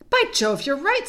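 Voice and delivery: high, loud astonished agreement; amazed. Acted. Peter sees that Susan is right, amazed. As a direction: exclaim as the truth dawns.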